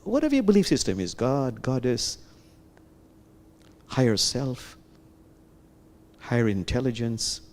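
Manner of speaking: gentle guided meditation voice. Acted. Teacher guiding listeners inward, in gentle meditation voice. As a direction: lead them inward, calmly.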